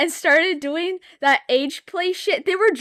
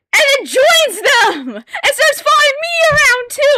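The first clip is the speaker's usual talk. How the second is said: much higher, louder crying shout; outraged shout; 13 semitones higher, 10 dB louder than usual. Real, not acted. Telling how Gojo betrayed her, outraged crying shout. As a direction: wail it out in disbelief.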